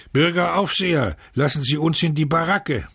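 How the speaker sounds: high-pitched appeal to a guard; pleading call. A narrator's reading. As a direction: call out to be let in. Prisoners calling after the guard.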